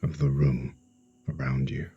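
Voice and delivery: slow meditation voice with long pauses; guided sleep hypnosis. Acted. Hypnotherapist guiding a listener to relax for sleep, slow and soothing. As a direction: ease the listener into stillness, phrase by phrase.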